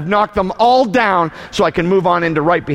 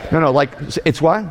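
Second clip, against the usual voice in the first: normal talking voice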